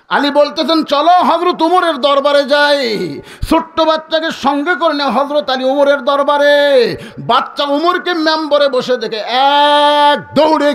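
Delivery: high, drawn-out chanting narration; ringing sermon climax; preaching. Acted. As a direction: sing out the story with stretched line endings.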